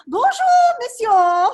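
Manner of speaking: bonjour, monsieur flung out high and loud in a hammed-up French accent; showy French greeting. Fop greeting Chauvelin with an overdone loud French accent. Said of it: "As the French say".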